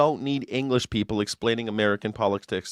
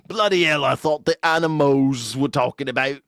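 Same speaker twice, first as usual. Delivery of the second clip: mock English accent; 3 semitones higher than usual. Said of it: "They're like".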